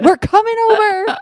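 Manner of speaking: high sing-out with a laugh; playful calling out. Pretend. Biker with chariot to friend next door, playful high call. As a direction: announce the visit in mock excitement.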